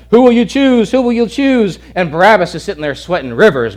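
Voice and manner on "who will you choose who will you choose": high, loud yelled demand; screaming and yelling. Retold or imitated. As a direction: yell it at the crowd. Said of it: "screaming and yelling".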